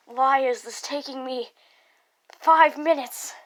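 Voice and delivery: loud, rising exclamation; loud exasperation. Real, not acted. Reader seeing how long the recording takes, loud exasperation. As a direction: build to an exasperated shout.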